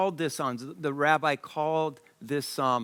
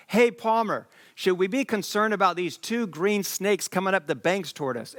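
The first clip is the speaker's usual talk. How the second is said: alarmed yell; 5 semitones higher than usual. Retold or imitated. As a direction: call out a warning across the riverbank. Cousin yells about snakes at river.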